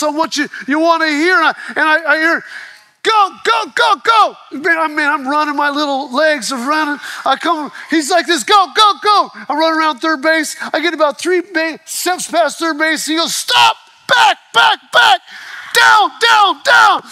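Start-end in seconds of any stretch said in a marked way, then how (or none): 3.0-4.4 high loud cheering yell; urgent coach shout, go go. Retold or imitated. Coach yelling during the run. 8.4-9.3 high loud cheering yell; urgent coach shout, go go. Retold or imitated. Coach yelling during the run. 13.3-17.0 high, loud urgent bellow; frantic high coach shout; coach yelling stop. Retold or imitated. Coach yells to stop and dive.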